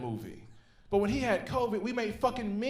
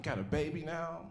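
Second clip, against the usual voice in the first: ordinary talk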